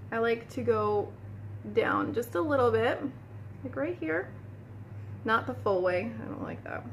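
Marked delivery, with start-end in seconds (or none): none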